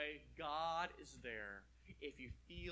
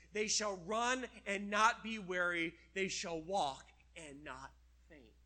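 slow, rising preacher's reading of scripture; preaching scripture; 2 semitones higher, 7 dB louder, slower than usual. Acted. Preacher reading Isaiah aloud to the congregation, slow and loud. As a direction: proclaim the verse slowly and loudly.

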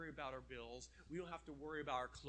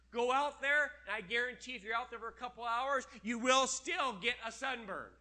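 emphatic preaching; 6 semitones higher, 10 dB louder than usual. Acted. Emphatic sermon point.

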